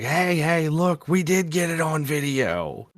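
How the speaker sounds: high, slow mock coworker voice; lightly voiced eager coworkers; excited coworkers. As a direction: play the coworkers, drawn out and high. Coworkers retelling video discovery.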